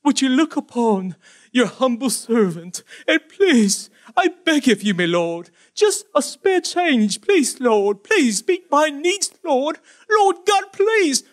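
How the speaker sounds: high, drawn-out groveling plea with a laugh; mock begging prayer. Preacher mocking believers begging God, high whiny plea. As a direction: grovel like a beggar asking for spare change. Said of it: "Some of us would go to God like that".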